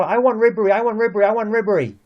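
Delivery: mock a spoiled kid demanding a toy; whiny child. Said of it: "They're like children".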